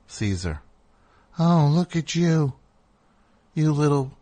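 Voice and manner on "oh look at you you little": light mock coo, a little higher; dog voice. Groomer greeting a client's dog, lightly imitated dog voice. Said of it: "the dog voice".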